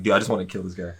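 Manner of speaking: low, soft imitation of brooding; muted mock vengefulness. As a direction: say it low, under your breath. Voicing Spider-Man out for blood.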